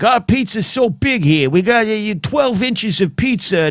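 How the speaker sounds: high, hammed-up New Yorker brag; mock New Yorker. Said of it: "you're like".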